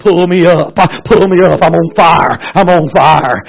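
loud pleading cries; shouted terror; desperate shout. Retold or imitated. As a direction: beg to be pulled out.

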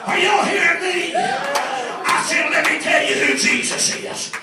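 high, shouted revival preaching; impassioned, strained testimony at a shout; full-force preaching. Real, not acted.

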